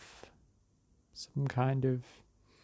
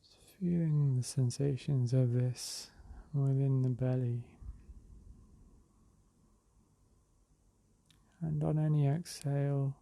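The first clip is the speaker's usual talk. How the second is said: unhurried meditation voice with long pauses; guided meditation. Real, not acted. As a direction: ease them into the breath, drawing words out. Leads a breathing meditation.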